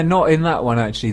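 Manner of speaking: ordinary delivery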